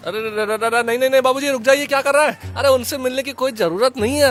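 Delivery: rising panicked pleas to stop; frantic, high calls to hold him back; alarmed pleading. Acted. Son frantically stops his father from going.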